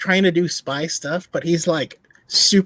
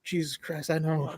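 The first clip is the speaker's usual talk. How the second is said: muted exasperation; 10 dB quieter than usual. Acted. Reacting to a painfully obvious spy, quietly exasperated. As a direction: drop the voice right down, as if fed up.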